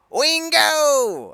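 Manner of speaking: high, loud drawn-out cry; Dale's Wingo. Retold or imitated. Imitating Dale from the cartoon, a long high yell. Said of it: "Whenever Dale says".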